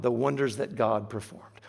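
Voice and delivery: ordinary talk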